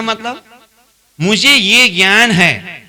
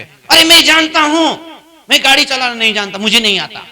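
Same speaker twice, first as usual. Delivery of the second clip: exasperated admission; 7 semitones higher, faster than usual. Acted. Listener finally admits after long argument.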